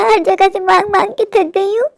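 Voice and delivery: words breaking into tears; crying. Real, not acted. Mother tells son she's exhausted, crying. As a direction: sob through the line.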